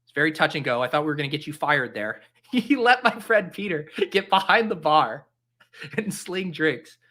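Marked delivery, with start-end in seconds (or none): 2.5-7.0 laughing through the words; amused, breaking up mid-story. Real, not acted. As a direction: tell it through a burst of laughter. Laughs while telling bar story.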